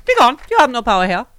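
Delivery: loud, high-pitched theatrical good witch; Glinda. Acted. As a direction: banish them grandly in a put-on accent. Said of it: "Somewhere like the Queen".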